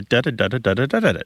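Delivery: fast "da da da" rhythm chant; rhythmic da da. Shown as a demonstration. Host mimicking the tongue twister's beat, quick and even. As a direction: rattle off the da's quickly, even beats. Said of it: "it's got a".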